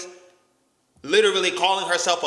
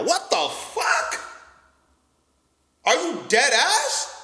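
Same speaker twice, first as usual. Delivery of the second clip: disbelief; 5 semitones higher, slower than usual. Real, not acted. Reacting to girlfriend's demand.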